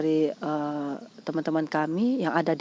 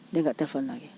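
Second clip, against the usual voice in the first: usual speaking voice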